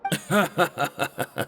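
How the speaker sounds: a short amused chuckle; light laugh. Acted. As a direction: laugh off the teasing with a brief chuckle. Cook laughs at the Duke's teasing.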